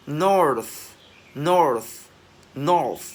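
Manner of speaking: "north" loud, with a long airy TH; loud released TH. Shown as a demonstration. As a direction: project "north" and push out the final TH. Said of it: "bật càng to thì càng hay".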